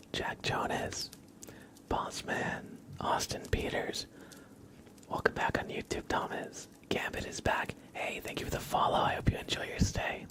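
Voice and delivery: ordinary delivery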